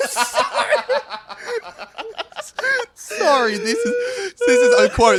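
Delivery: squeaky, high voice cracking with laughter; trying to talk through uncontrollable laughter; laughing fit. Real, not acted. Reading out a quote while he can't stop laughing.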